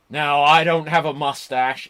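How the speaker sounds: loud imitation of a wrestler's promo; Eli Cottonwood. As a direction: announce it loudly, mimicking a wrestler. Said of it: "And he was like".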